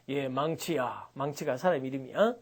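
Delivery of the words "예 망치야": lower, slow, softer boss order; gangster boss voice. Retold or imitated. Released gang boss calling his underling by name. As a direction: call your underling calmly. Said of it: "시다바리에게".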